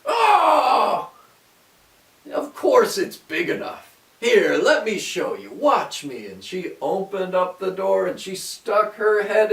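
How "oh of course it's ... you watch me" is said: high, loud impatient witch. A narrator's reading. Witch insists the oven is big enough, loud and impatient. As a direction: scoff and show her. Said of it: "she said".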